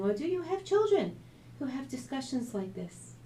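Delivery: ordinary delivery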